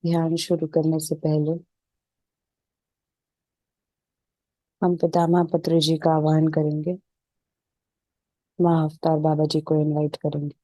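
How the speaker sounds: even, unhurried meditation voice with little pitch movement; settled, level relaxation-session delivery; guided meditation. Real, not acted. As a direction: guide them calmly into stillness, leaving space between phrases.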